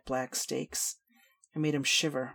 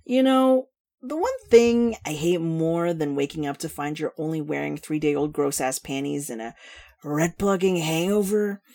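loud, high, drawling tipsy rant; brash drunk woman; 3 semitones higher, 7 dB louder than usual. Acted.

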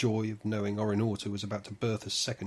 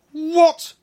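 piercing scream, far higher and louder; 17 dB louder than usual. Acted. Orinauta in labour screaming at the midwife, piercing shriek. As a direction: shriek it in pain and shock. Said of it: "Orinauta screamed".

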